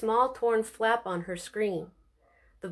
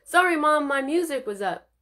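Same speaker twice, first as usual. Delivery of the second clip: calling out; 5 semitones higher, 3 dB louder than usual. Acted. Calling back to mother.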